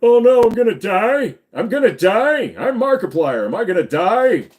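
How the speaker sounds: high, loud hammed-up YouTuber panic; mock Markiplier panic. Streamer mocking a YouTuber's practiced panic, high and loud. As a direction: ham up a scared YouTuber voice. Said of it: "making those noises in the voice".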